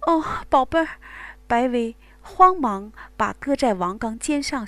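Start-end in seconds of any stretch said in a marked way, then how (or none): 0.1-0.9 a high, louder sigh of release. Acted. Wang Gang to Bai Wei, high release. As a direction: let it out at the peak. Male lover at climax.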